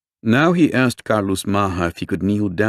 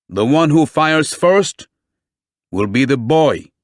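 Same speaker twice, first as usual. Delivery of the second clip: stern police chief; 7 semitones higher, 5 dB louder than usual. A narrator's reading. Chief giving firm orders.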